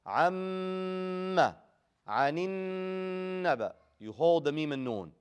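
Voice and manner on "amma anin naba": "Amma" and "anin naba" with the m and n held very long, higher; long held nasal. Shown as a demonstration. Teacher shows mim and noon with shadda, held long. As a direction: hum long on the doubled m and n. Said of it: "You hold it very long".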